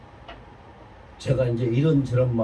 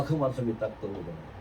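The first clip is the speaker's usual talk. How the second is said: ordinary talk, nothing marked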